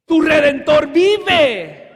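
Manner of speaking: loud, slow, high proclamation; preacher shouting the refrain, slow and loud; proclaimed. Real, not acted. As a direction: thunder the refrain to the congregation. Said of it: "preaching the refrain".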